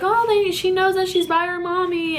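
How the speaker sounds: cooing like the nurse did; imitating the nurse. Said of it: "this is what the nurse is saying".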